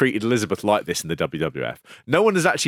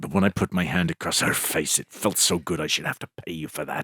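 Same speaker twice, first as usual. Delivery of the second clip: imitating wrestling villain; 5 semitones lower than usual. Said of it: "He says".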